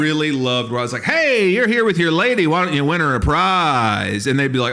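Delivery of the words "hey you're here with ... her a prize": high, loud coaxing pitch; carnival barker. Retold or imitated. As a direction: goad him to win a prize. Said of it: "We were literally carnival barkers".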